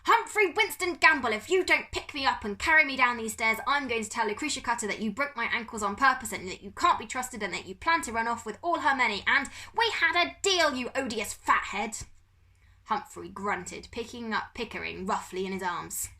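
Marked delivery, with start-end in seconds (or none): 0.0-12.0 high, loud furious tirade; screaming rant. A narrator's reading. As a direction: scream the threat at him. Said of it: "Pickering started screaming".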